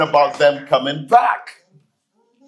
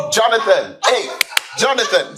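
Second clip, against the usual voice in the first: much higher, calling out; calling out a name; 9 semitones higher than usual. Retold or imitated. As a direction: call the name across the room. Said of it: "He's saying".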